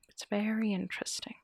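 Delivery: soft whispered aside; whispering. Real, not acted. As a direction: whisper it conspiratorially. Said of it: "whispered aside".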